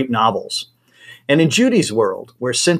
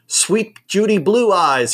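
put-on slow, higher, buoyant Germanic accent greeting; 8 semitones higher, slower than usual. A narrator's reading. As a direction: greet her warmly in a cheery Germanic accent. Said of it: "he exclaims in his buoyant Germanic accent".

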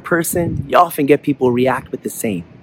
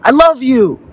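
high, loud call with a falling end; yelling; 11 semitones higher, 5 dB louder, slower than usual. A narrator's reading. Father demonstrates the echo to his son, yelling. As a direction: shout it out into the distance. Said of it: "He yells".

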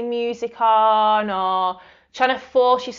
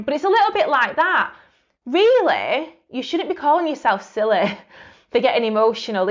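ordinary delivery

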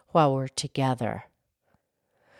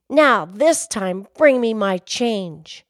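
slow, loud, higher demand; stern demand; 4 semitones higher, 6 dB louder, slower than usual. Acted. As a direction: lay down the order word by word. Demanding change from manager.

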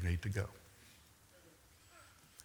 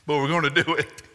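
a cheeky, louder punchline in a put-on voice; mock sly aside; 5 semitones higher, 6 dB louder than usual. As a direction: tack it on like a sly wink after the prayer. Sailors' punchline after prayer.

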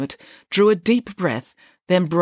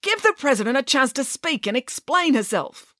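cross, higher-pitched telling-off; exasperated reprimand; snapped; 8 semitones higher than usual. A narrator's reading. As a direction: snap an order at squabbling girls. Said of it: "snapped Mister Lang".

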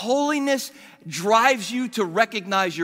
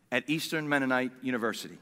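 ordinary talk

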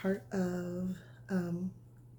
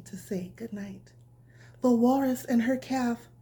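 bedtime story reading; 3 semitones higher, 8 dB louder than usual. A narrator's reading. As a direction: read it gently like tucking a child in. Reads bedtime story to children.

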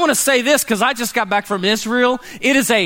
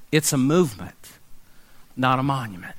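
lower, softer, hushed restatement; soft repeat; 8 semitones lower, 6 dB quieter than usual. Acted. Pastor to congregation, softer and lower slogan. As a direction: let the slogan sink in quietly.

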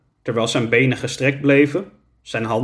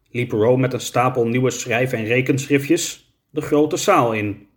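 ordinary delivery

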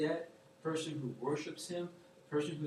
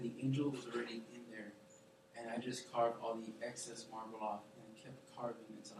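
soft voice; 6 semitones lower, 10 dB quieter than usual. Retold or imitated. God's answer voiced softly.

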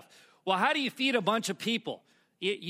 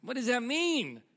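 higher, acted-out bewilderment; baffled question; 6 semitones higher than usual. Narrator to cousin about odd word, baffled. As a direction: play up total confusion.

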